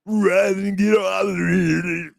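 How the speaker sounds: mock her sounds like a movie villain bellowing; imitating her demon noises. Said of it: "that's how she sounded".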